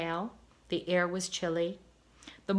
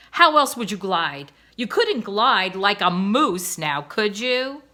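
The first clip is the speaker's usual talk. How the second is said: louder, exasperated "ugh" and eye-rolling put-down; mock-irritated wife brushing off her husband; scoffing wife; 8 dB louder than usual. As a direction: deflate his grand talk with a sneer.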